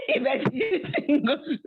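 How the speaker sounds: high, slow words swallowed by laughter; laughing mock warning. Cake eater warning God at the house, laughing mock warning. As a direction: crack up mid-threat.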